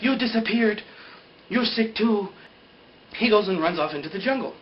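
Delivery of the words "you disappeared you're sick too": high, raised-voice retold accusation; mock accusation. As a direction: turn on him, acting it out. Acts out Danielle turning on Jin.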